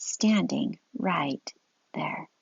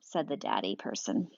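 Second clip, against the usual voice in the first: usual speaking voice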